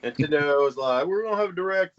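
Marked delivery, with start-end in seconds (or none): 1.1-2.0 mock announcement. Voicing Nintendo.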